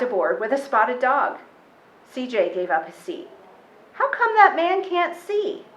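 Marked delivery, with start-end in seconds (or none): 3.9-5.7 put-on high, innocent little boy's question; a child puzzled aloud; wondering child. A narrator's reading. CJ asking Nana about the blind man, high and innocent.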